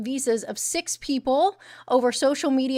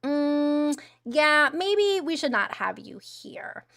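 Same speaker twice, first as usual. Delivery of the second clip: high, slow mock officials' voice; 5 semitones higher, much slower than usual. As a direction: voice the government smugly considering it, slowly. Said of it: "to be like".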